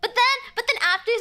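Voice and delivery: usual speaking voice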